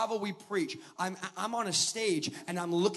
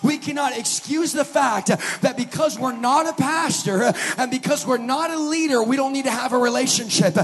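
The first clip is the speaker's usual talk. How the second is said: full-force preaching; 4 semitones higher, 9 dB louder than usual. Acted.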